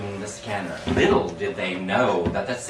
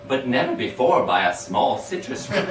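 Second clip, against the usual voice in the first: cracking up at the line; laughing through it; 6 semitones higher, 4 dB louder than usual. Real, not acted. Reader cracking up at the citrus fruit line. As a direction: laugh while reading the punchline.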